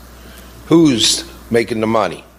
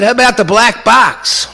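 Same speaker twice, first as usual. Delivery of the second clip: challenging question; 11 semitones higher, 5 dB louder than usual. Retold or imitated. Confronting general at family briefing.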